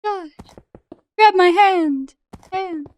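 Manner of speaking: high, loud urgent calls; shouting. Real, not acted. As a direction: yell for him to grab on. Calling to friend in the lava.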